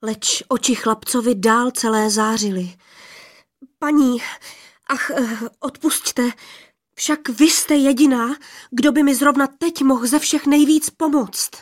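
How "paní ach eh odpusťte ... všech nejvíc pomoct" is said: higher and louder, pleading in a rush of nerves; anxious eager plea. Acted. Young man anxiously begging the rainbow lady for help. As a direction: beg her for help, half afraid she won't listen. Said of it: "A celý ze strachu ... z úzkosti".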